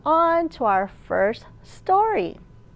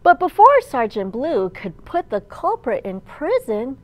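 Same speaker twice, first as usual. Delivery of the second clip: lively picture-book storytime voice; reading to children; 3 semitones higher, 4 dB louder than usual. A narrator's reading. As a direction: read it aloud to a room of little kids.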